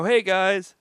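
high, loud hello to a crowd; stage greeting. Retold or imitated. Comedian greeting a party crowd, loud stage greeting.

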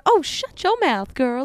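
slow, sweet Southern scolding; old Southern woman. Acted. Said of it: "like an old Southern woman going".

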